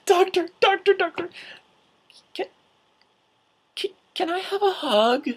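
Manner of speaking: very high, eager falsetto; imitated fangirl. Fan girl asking the Doctor for a hug, high-pitched pleading. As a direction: mimic a breathlessly keen young fan.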